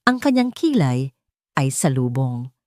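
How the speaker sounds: plain reading voice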